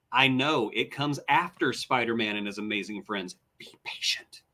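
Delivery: teasing whisper. Acted. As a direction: hush it like a secret. Teasing co-host about upcoming episodes.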